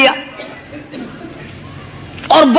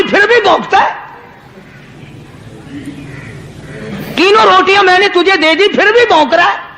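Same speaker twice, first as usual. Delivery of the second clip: raised, heated rebuke of a dog; angry scolding; 6 semitones higher than usual. Retold or imitated. Saint scolding the barking dog, pitched high and angry. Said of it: "غصے میں آ گیا".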